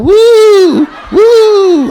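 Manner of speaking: high, loud mock moans; hammed-up bedroom woo sounds; mock moaning woo. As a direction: squeal out two silly moans.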